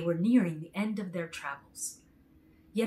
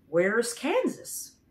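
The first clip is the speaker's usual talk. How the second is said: slow, puzzled question; never heard of the place; surprised; 4 semitones higher, 5 dB louder, much slower than usual. A narrator's reading. Man asking Dorothy about her home, slow and surprised. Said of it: "asked the man with surprise".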